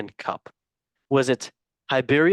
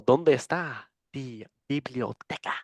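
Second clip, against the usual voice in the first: mock Spanish; 3 dB quieter than usual. Joke reply to Spanish answer.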